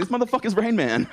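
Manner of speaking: high, laughing mock amazement; amazed verdict. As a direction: blurt it out cracking up. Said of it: "And Ben's just like".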